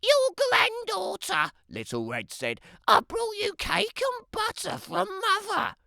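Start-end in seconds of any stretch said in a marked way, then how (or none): 0.0-1.5 put-on much higher, sing-song girlish voice; a sweet, self-admiring child's voice; vain little girl. Little Red voiced by narrator. 2.9-5.8 put-on much higher, sing-song girlish voice; a sweet, self-admiring child's voice; vain little girl. Little Red voiced by narrator.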